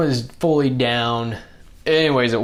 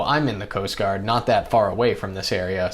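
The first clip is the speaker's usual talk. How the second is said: ordinary delivery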